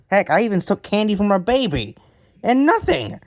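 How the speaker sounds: loud, high outraged complaint; indignant rant. Acted. Villain guest ranting at the host, loud and outraged. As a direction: rail at the unfair world.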